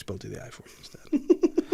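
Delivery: unvoiced whisper aside. Real, not acted. Host dodging a risky impression, whispering. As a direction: whisper it conspiratorially.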